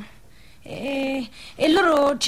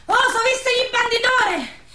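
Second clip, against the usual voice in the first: excited news; 7 semitones higher than usual. Acted. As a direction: call out the news, high-pitched. Breathless news of the town crier.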